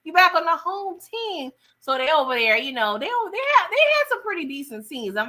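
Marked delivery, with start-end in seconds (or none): 0.0-1.5 teasing. Retold or imitated. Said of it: "I'm like".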